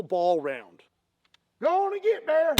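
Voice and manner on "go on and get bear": high yell at a bear; scaring off a bear. Acted. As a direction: holler to shoo him away. Yelling at bear before firing.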